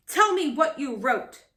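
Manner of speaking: demanding. Acted. Captain interrogating Molly, angry demand. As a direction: demand the answer. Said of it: "demanded Captain Firebeard".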